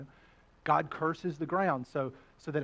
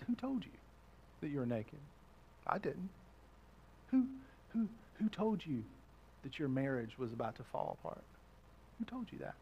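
much softer, hushed questions; God's gentle voice; 5 semitones lower, 11 dB quieter than usual. Acted. God gently asking Adam and Eve, hushed and tender. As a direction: ask it tenderly, almost in a hush. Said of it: "And he says".

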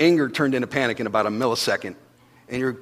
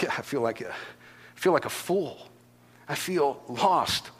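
quiet despair; 9 dB quieter, slower than usual. Retold or imitated. Drowning person admits feeling lost.

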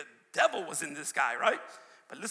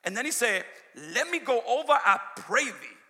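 louder, declaiming King James verse; scripture reading; 2 semitones higher, 5 dB louder than usual. Acted. Preacher declaiming scripture to the church.

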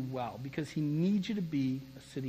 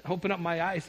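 ordinary talk, nothing marked

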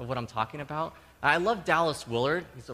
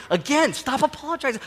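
high, very loud exasperated outburst; imitated yelling; 11 semitones higher, 7 dB louder than usual. Wife shutting down husband's apologies, imitated yelling. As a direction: yell it high, like a fed-up partner. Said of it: "She just kept yelling".

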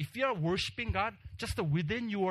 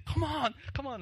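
urging yell; 10 semitones higher than usual. Retold or imitated. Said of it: "we're yelling at his name".

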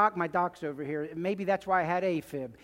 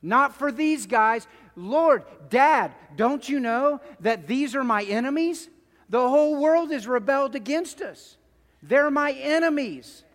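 louder and higher, rising to a pleading shout; imagined outcry; 3 semitones higher, 6 dB louder than usual. Retold or imitated. Jesus imagining calling off the cross, pleading shout. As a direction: cry it out to the Father as if refusing the cross. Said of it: "He could pull the curtain down and say".

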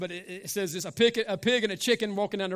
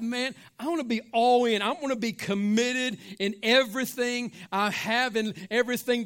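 raised, higher preacher's voice, driving on; fervent preaching; 4 semitones higher than usual. Real, not acted. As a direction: preach it with rising conviction. Preaches at full force.

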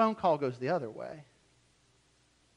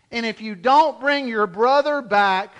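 ordinary delivery

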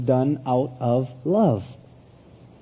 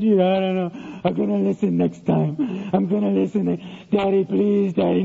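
imitated tearful little-boy pleading; whimpering child; 2 semitones higher than usual. Son promises to listen next time, tearfully pleading. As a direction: beg Daddy through mock sobs. Child cries and begs father.

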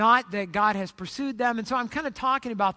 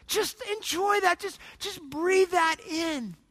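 soothing invitation; 6 semitones higher, slower than usual. Acted. Preacher inviting listeners to breathe in God's love, soothing. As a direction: drop the volume and stretch it out slowly.

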